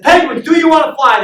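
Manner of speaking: high, loud pep-talk call; rallying shout. Acted. Coach rallying a crowd of penguins, rallying shout. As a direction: fire up the troops with a shouted question.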